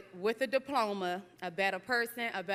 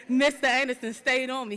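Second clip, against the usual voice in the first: name called out louder, with real gratitude; emphatic thanks; 4 semitones higher, 9 dB louder than usual. Real, not acted. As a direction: proclaim the teacher's name loudly.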